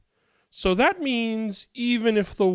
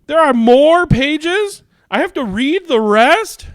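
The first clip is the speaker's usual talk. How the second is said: high, loud, slow dismay; dismayed surprise; 10 semitones higher, 10 dB louder than usual. Acted. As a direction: gasp in mock horror. Dismayed at more pages.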